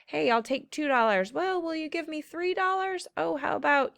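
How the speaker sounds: lightly voiced haggler going back and forth; playful mock haggling over dollars; haggling buyer. Teacher acting out a haggler making offers, playfully. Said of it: "bargaining might be someone who".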